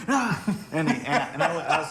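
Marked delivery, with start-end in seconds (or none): 0.1-0.4 sudden high, loud attacker's shout; mock attack yell. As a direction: jump out yelling like an attacker. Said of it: "and they go".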